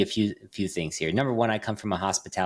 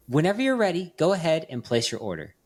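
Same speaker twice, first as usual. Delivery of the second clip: higher, sweet mock drive-thru assistant; nice polite voice; 7 semitones higher than usual. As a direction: imitate the overly polite AI taking an order. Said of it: "in a very nice, polite voice".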